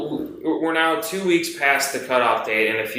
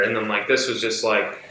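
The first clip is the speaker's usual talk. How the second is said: ordinary talk, nothing marked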